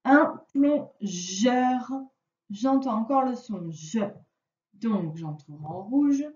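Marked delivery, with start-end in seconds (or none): 0.0-1.9 slow dictation. Shown as a demonstration. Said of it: "On passe à la ligne".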